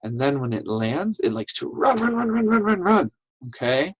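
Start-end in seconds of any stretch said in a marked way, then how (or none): 1.7-3.1 high, rapid-fire repeated word; playful rapid patter. Shown as a demonstration. Teacher acting out the road runner, playful rapid patter. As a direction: race through the repeats like scurrying feet.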